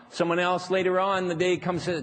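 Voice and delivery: normal talking voice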